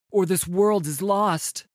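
loud, high shouted warning; urgent shout. A narrator's reading. Warrior warning the dragon of the world's loss, urgent shout. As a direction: shout it as an ultimatum. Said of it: "this Pantheon shouts".

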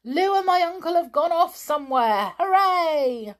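loud, very high gleeful shout; a boy yelling happily as he runs to the lake. A narrator's reading. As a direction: yell it out while waving. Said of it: "waving and yelling".

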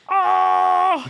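high pained yelp; sharp yelp. Pretend. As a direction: yelp out like you're hurt. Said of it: "imagine a guy behind you".

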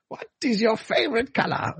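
high, put-on voice asking the question; bridge keeper quote. Quoting Monty Python bridge keeper.

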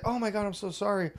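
high, softened female voice; imitating apologetic wife. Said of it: "And she's like".

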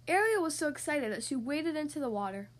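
ordinary delivery, nothing marked

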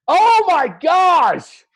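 very high, loud, long-stretched gasp of horror; shocked exclamation. Real, not acted. Co-host sees photo from two days later, shocked. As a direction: wail it slowly in disbelief.

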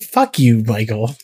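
ordinary talk, nothing marked

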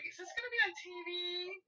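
high, eager mock voice; imitated starstruck question; excited onlooker. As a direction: ask like a thrilled mall shopper. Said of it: "we had people going".

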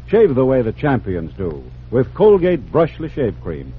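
ordinary talk, nothing marked

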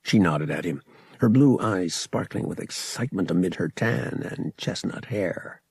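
ordinary delivery

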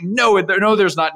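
high, stumbling denial, playfully acted; dismissive mom. Mom denies the dragon is there, dismissive. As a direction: shut the kid down.

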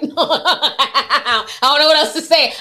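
slow, laughing through the words; gloating giggle while talking. Real, not acted. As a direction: laugh it out, pleased with yourself. Laughing at doubting fanboys.

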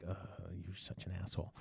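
very soft, low mock reproach; hushed reply. Deb answering the man's confession, hushed mild reproach. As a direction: scold privately, barely audible. Deb replies privately in line.

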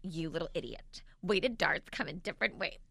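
impression of Tim. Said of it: "not great at impersonations either".

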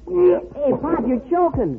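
sudden loud alarmed call; alarmed shout. Acted. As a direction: yell out in alarm. Son sees father choking.

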